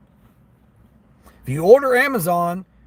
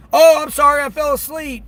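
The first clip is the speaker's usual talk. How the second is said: high, louder whiny mock excuse; mocking customer; 10 semitones higher, 6 dB louder than usual. Driver mocking a no-tip customer who overslept, high mock whine. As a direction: mimic her lame apology.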